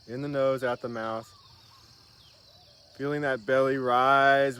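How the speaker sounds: wellness guru to viewers, calmly leading a breathing meditation; guided breathing meditation. Acted. Leads breathing exercise on camera.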